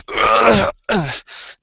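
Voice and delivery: high effortful lifting grunt; acted groan of exertion; straining grunt. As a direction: grunt as if heaving a tree up. Goliath lifts the tree.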